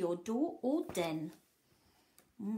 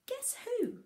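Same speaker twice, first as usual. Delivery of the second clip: teasing; 13 semitones higher than usual. A narrator's reading. Teasing question to children.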